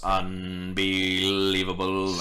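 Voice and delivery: slow, stretched-out word on a level pitch; drawn-out disbelief. Acted. Robot sitting there before it falls apart, drawn-out disbelief. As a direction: draw it out slowly in disbelief. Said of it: "sitting there and goes".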